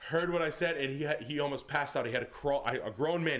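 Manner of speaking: ordinary talk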